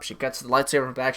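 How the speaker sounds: ordinary delivery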